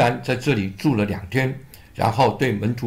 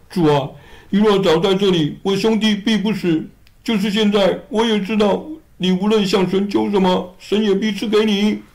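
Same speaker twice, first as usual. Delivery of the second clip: higher pleading voice for Martha; higher-voiced sister, gently reproachful; grieving Martha; 7 semitones higher, 3 dB louder than usual. Martha voiced high to Jesus.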